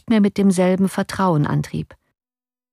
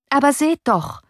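high-pitched exclamation, mildly raised voice; calling out; 8 semitones higher than usual. A narrator's reading. Officer protesting to the young man, calling out. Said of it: "rief der Offizier".

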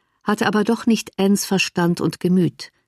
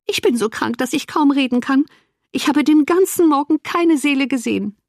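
higher-pitched, self-pitying woman; complaining invalid sister; 7 semitones higher than usual. Acted. Ailing woman greets her late-arriving sister with complaints, aggrieved.

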